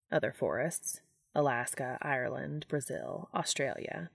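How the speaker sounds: quietly level, unhurried; lightly voiced calm angel. Castiel answering Dean on the forest floor, lightly low and soft.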